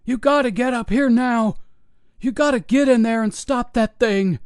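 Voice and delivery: high, urgent, panicked yelling; frantic scream. Acted. Security guard begging the police to stop the monster, frantic high shout. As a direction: Pat screams at police to hurry upstairs, panicked. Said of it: "Pat screamed at them".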